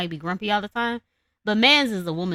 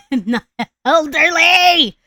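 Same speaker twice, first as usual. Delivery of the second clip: put-on higher, louder, drawn-out elderly!; indignant shout; 7 semitones higher, 9 dB louder, much slower than usual. Shown as a demonstration. Streamer rejects being called old, shouting elderly slowly. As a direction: protest it like she is deeply offended. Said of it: "it pissed me off".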